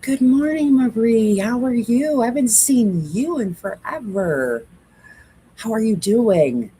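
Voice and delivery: high, loud, cheerful hello; excited greeting. Real, not acted. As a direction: greet a friend you haven't seen. Greeting viewer on live.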